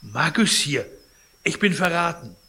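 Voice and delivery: cry of betrayal. Acted. Sick man cries out betrayed.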